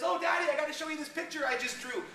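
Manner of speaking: a hint of a kid showing off a drawing; eager little girl.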